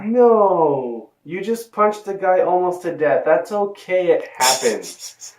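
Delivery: high, sarcastic reassurance; mock consoling. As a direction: pat her on the head for nearly killing a man.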